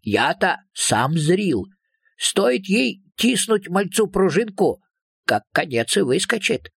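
lightly voiced dialect-speaking creature, drawn out; slow down into a folksy little-creature voice. A narrator's reading. Second creature goes on in dialect. (слегка наигранный голосок сказочного человечка, медленно)

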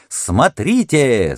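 high, slow barker call falling; drawn-out showman's call; booming circus ringmaster. Acted. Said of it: "заводил Хардинг".

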